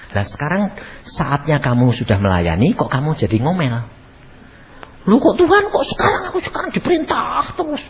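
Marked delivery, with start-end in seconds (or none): 5.1-7.8 whiny complaint. Retold or imitated. Wife whining to God.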